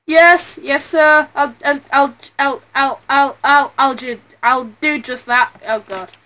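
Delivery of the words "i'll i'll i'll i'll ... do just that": normal talking voice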